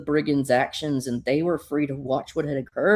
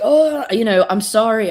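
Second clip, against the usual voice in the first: put-on higher, louder girl apologizing; little girl's apology; 7 semitones higher, 7 dB louder than usual. Girl waking to apologize to Supergirl, apologetic and high-pitched. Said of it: "the girl's like".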